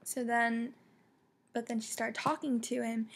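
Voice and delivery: ordinary talk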